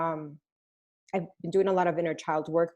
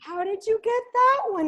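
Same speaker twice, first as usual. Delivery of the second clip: surprised mom; 14 semitones higher than usual. Retold or imitated. Mom amazed at the copy.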